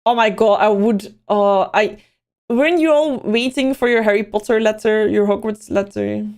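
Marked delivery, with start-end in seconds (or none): none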